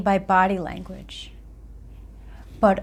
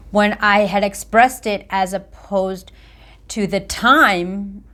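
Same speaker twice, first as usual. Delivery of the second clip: ordinary delivery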